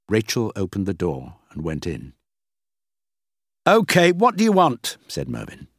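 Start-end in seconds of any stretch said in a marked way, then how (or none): 3.7-5.0 high, loud and impatient; irritated. A narrator's reading. Busy boss to Rachel who walked in, irritated. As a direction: give in with obvious annoyance.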